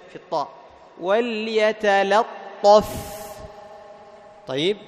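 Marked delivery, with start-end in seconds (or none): 1.0-2.9 slow, drawn-out "وليتلطف", thin la then heavy ṭṭo; heaviness only on ṭa. Shown as a demonstration. Teacher models correction for students, slow "وليتلطف" with thin lam, heavy ṭa. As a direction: recite "وليتلطف" slowly, keeping l light before ṭ. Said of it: "تجعل التفخيم فقط فين؟".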